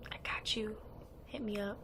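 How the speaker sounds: soft, whispered retort; retold line, barely voiced; hushed aside. Hushed flirty aside in retold chat.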